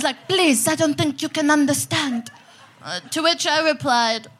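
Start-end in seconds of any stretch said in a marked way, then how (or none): 0.3-2.3 bad French accent. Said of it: "she was French".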